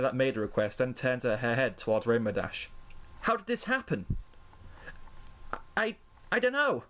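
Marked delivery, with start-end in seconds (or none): 3.2-4.2 high-pitched shocked pony voice; alarmed questioning. A narrator's reading. Alarmed pony questions Rainbow Dash. 5.8-6.8 high, stammered denial; hesitate, then deny it in a high voice; nervous lie. A narrator's reading. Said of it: "Rainbow Dash lied".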